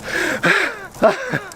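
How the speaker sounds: long weary sigh; heavy sigh, rising at the end; tired sigh. Real, not acted. Embarrassed about his cardio.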